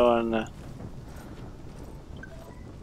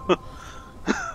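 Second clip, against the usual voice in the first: short breathy nervous laugh. Real, not acted.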